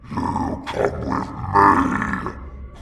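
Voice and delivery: a ghoul dragging its victim; Bogman cry. A narrator's reading. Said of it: "cried Bogman".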